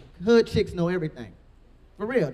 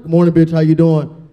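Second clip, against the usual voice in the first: much louder booming greeting; 11 dB louder than usual. Retold or imitated. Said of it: "I was like".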